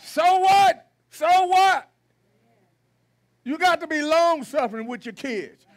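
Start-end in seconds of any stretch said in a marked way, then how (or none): 0.0-1.9 shouted, higher "So what?" twice; loud dismissive retort. Real, not acted. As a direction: throw it back at them loudly. Preacher dismissing the excuse.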